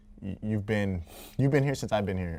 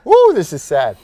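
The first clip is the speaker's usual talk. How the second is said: loud emotional exclaim; 9 semitones higher, 17 dB louder than usual. Real, not acted. Overcome at colleague's farewell.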